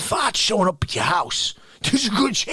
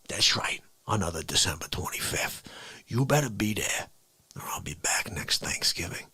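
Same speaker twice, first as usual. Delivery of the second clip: low, hushed mob threat; menacing low whisper; 13 semitones lower, 8 dB quieter than usual. Acted. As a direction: whisper it like a final warning.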